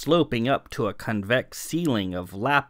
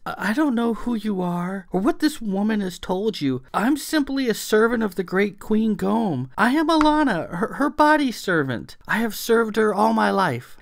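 much higher, stammering servant's plea; frightened servant; 11 semitones higher than usual. A narrator's reading. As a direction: plead for mercy in a high small voice. Frightened servant pleading her case.

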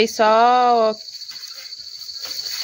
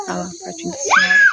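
excited call; 12 semitones higher, faster than usual. Real, not acted. Showing off mother's plate.

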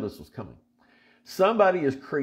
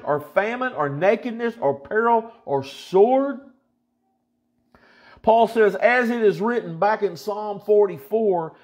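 higher, fervent scripture reading; preaching; 8 semitones higher than usual. Acted. Preacher reading Romans to believers, fervent and raised. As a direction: preach the verses with rising fire.

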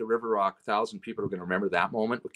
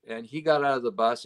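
ordinary talk, nothing marked

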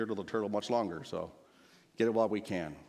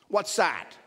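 high, louder startled snap; snapped; 8 semitones higher, 5 dB louder than usual. A narrator's reading. King hearing a sigh from below, snapped question. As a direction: snap it out sharply. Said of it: "snapped the king".

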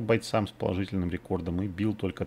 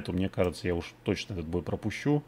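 ordinary talk

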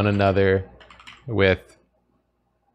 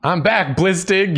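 raised, higher call across the room; 8 semitones higher than usual. Acted. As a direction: call out on entering. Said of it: "calling out".

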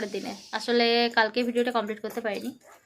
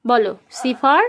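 put-on bright, loud sing-song quizzing of a toddler; loud child-directed teaching; 4 semitones higher, 7 dB louder than usual. Mother quizzing her toddler on letters, in a loud sing-song. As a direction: coax the little one through letters and numbers, loud and eager.

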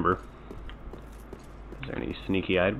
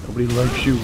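angry taunt; 4 semitones higher, 7 dB louder than usual. Real, not acted. Insulting enemy loudly.

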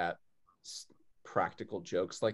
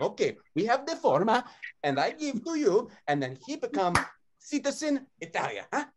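put-on Italian accent; 13 semitones higher than usual. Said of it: "in an Italian accent".

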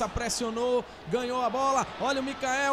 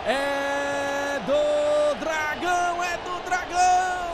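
loud stretched celebration; goal celebration; 5 dB louder, slower than usual. Real, not acted. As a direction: celebrate the team loudly. Celebration chant.

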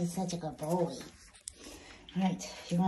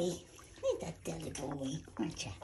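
tender; 6 semitones lower, 8 dB quieter than usual. Real, not acted. Tender low cooing.

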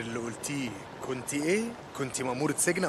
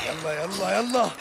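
rising higher and louder to the last word; urgent urging; 5 semitones higher, 4 dB louder, slower than usual. Acted. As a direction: hurry them along, building in pitch and force. Urging someone on in danger. (استعجال بصوت يعلو)

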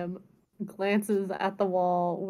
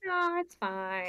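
unbothered dismissal; 2 semitones higher, 4 dB quieter, slower than usual. Acted. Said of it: "Zarus is just like".

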